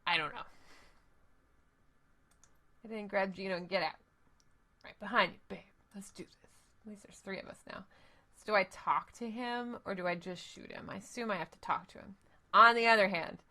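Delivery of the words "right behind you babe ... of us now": whisper. Acted. Character whispers joining the fight.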